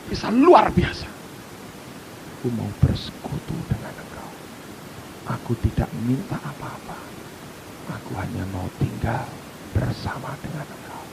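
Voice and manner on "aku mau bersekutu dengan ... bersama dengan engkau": soft, low, hushed plea; gentle hushed voice of the Spirit; tender whisper. Acted. Voicing Holy Spirit's gentle plea.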